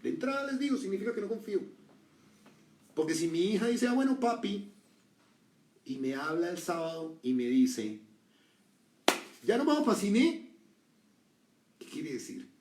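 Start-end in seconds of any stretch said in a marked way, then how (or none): 3.9-4.7 trusting daughter. Retold or imitated. 9.4-10.5 put-on high, loud, eager child; excited daughter. Preacher voicing his trusting daughter, high and excited.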